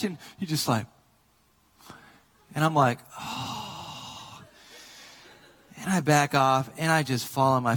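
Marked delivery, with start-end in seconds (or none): 3.2-4.4 soft, voiceless gasp of mortification; breathy "oh". Retold or imitated. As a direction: let out a long, hushed "oh". Mortified reaction after being caught.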